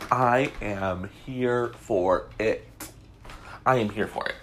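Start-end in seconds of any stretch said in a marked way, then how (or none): 0.1-2.7 stretched out, relishing each word; slow, savoring. Acted. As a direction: drag it out with glee. Relishing gossip, drawn out.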